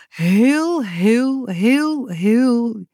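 high, sing-song, drawn-out HEEL; gushing emphatic repetition. A narrator's reading. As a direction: keep the HEELs rolling in waves. Carries on the endless repetition. (zangerig herhaald "heel")

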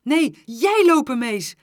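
loud, high insistent cry; shouted retort. A narrator's reading. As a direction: yell the order straight back. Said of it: "riep ik".

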